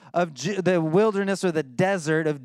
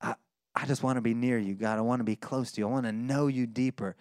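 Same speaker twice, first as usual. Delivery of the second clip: soft, low, longing plea; hushed yearning to be near God; quiet, low-pitched devoted prayer; 6 semitones lower, 4 dB quieter than usual. Retold or imitated. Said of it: "he's saying".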